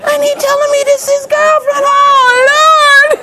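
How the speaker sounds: hammed-up high wail, building to a cry; horrified wail. Mother hears this is son's girlfriend, horrified wail. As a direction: wail it to heaven in mock despair. Mother's acted horror at the news.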